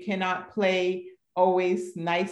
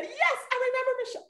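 imitating startled lady; much faster than usual. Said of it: "She said".

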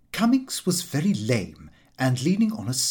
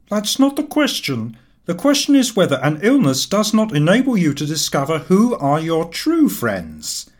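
higher, louder put-on voice of a sulky sick friend; aggrieved invalid; 5 semitones higher, 5 dB louder than usual. A narrator's reading. Cummings complaining to his neglectful friend, aggrieved and loud. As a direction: whine out the grievance, raised and loud.

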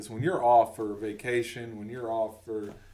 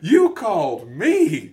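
higher, much louder, slow protest; mock indignation; 7 semitones higher, 14 dB louder, much slower than usual. Relaxed brain scolding the caller, slow indignant protest. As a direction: drag it out, offended. Eight-week brain outraged.

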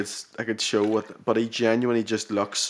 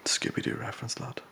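whispered aside; 9 dB quieter than usual. Real, not acted.

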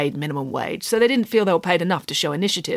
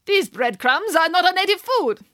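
high-pitched, hammed-up Brazilian accent; attempted Brazilian accent; 10 semitones higher than usual. A narrator's reading. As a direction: put on a dubious Brazilian accent for drama. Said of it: "trying to do a Brazilian accent".

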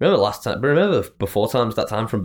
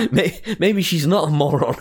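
laughing; 8 semitones higher than usual. Real, not acted. Laughing at her own thought.

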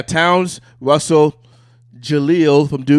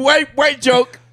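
high amused protest; 13 semitones higher, 4 dB louder, much slower than usual. Real, not acted. Mock outrage at joke.

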